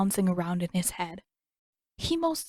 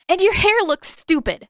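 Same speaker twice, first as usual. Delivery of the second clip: put-on high, loud childish jeer; shouted insult; 11 semitones higher, 7 dB louder than usual. Katara shouting after Zuko from her cell, loud jeer. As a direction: yell it after him as he leaves.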